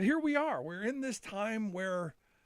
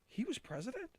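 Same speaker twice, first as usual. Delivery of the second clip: hushed, imitated disbelief; shocked disbelief; 5 semitones higher, 8 dB quieter than usual. Dad imitates his teenage son hearing the clip, shocked and hushed. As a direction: ask it softly and higher, as if stunned. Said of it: "in the most kind of shocked".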